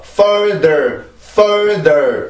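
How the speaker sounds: "further" drawn out with the jaw dropping open on both vowels; wrong dropped jaw. Shown as a demonstration. Teacher showing learners what to avoid, wrong dropped-jaw pronunciation. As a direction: stretch "further" slowly with an exaggerated open jaw. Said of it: "이렇게 내려오는 발음이 아니고".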